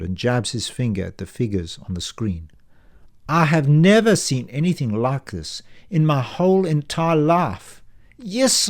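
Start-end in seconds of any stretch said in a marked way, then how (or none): 3.3-8.7 lightly voiced banker, higher and louder, amazed; a clerk marvelling at a customer's spending; astonished banker.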